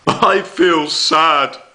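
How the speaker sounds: high, slow, hammed-up wail. Shown as a demonstration. Teacher showing Year Three how Bear wails, hammed-up wail. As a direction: wail the line like a crying toy bear.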